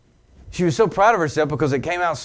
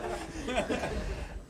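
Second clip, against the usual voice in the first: laughing; 10 semitones higher, 12 dB quieter than usual. Real, not acted. Laughs at the story.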